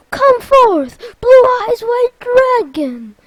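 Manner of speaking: loud, high summoning shout; battle summons. Acted. Sora answering Vexen's challenge, loud summons. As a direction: proclaim the monster with mock grandeur.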